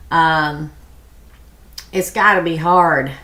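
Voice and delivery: ordinary talk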